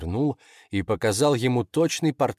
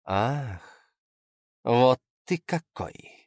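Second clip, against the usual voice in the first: slow, intrigued discovery; curious, lingering appraisal; suddenly interested; 3 semitones higher, much slower than usual. Acted. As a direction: draw it out with dawning interest. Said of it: "вдруг заинтересованно произнесла она".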